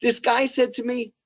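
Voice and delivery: ordinary talk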